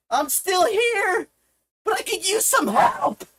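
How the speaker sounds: high, strained appeal for help; pleading. Real, not acted. Streamer still here asking for help, urgent pleading. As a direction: plead with them, pitch lifted and urgent.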